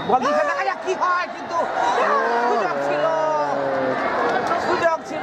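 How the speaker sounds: high-pitched cry at the missed chance; excited commentary. Real, not acted. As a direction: lift the voice as it slips away.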